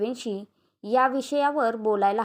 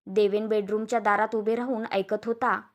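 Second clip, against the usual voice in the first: plain reading voice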